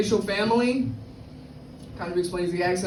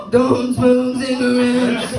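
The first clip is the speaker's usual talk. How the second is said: PSA voice; 6 dB louder, slower than usual. Pretend. Anti-tobacco ad voice.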